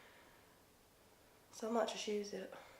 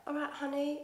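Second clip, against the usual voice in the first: mock Towie accent, pitched higher; 5 semitones higher, 3 dB quieter than usual. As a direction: put on a higher Towie-style Essex voice. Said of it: "a Towie accent where everything's like".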